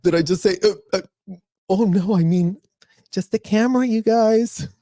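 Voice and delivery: high, faux-sweet cover-up; mock innocent lady. The public face of the swearing lady, high and mock sweet. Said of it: "here she is in public".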